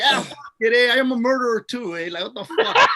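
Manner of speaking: hammed-up drunk boast; mock bravado. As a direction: play it loaded and careless. Retold drunk reply, mock bravado.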